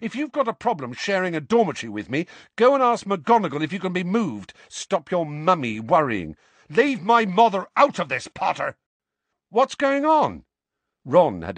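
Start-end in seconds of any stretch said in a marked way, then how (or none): 0.0-6.3 high-pitched, heated taunting; rising temper. A narrator's reading. Harry tells Seamus to move dormitory, hot-tempered and high. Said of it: "his temper rising so fast". 6.7-8.8 loud, high-pitched outburst; angry warning. A narrator's reading. Seamus defends his mother against Harry, loud and angry. 9.5-10.4 loud, very high startled question; startled demand. A narrator's reading. Ron walks in on a quarrel, startled and loud.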